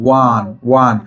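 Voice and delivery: ওয়ান said slowly as "wan", starting with a w sound; sounding out one. Shown as a demonstration. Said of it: "ওয়ান এর উচ্চারণটা কেমন হয়েছে".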